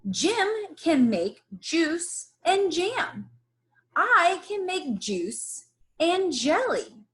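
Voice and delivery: lightly voiced eager Jan; Jan offering. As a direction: Jan keenly offering help.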